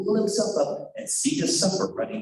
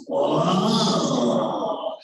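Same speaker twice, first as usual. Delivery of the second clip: stumbling whoa; 4 semitones lower than usual. Acted. Wolf stumbles again.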